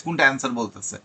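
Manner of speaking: normal talking voice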